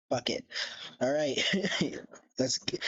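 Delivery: ordinary talk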